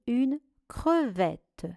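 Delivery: "crevette" split between cre and vette; syllable by syllable. Shown as a demonstration. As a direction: dictate "crevette" with a gap inside. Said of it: "Première syllabe creux". (« crevette » découpé syllabe par syllabe)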